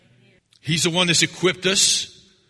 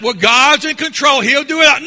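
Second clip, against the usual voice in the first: mock complacent believer; 10 semitones higher, 8 dB louder than usual. Mocking passive believer, shouted.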